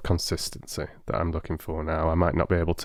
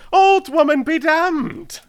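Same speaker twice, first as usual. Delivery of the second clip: much higher, louder, slow and sharp; sharp exclamation; 7 dB louder, slower than usual. Acted. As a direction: snap it out in scorn. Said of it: "said Sherlock Holmes sharply".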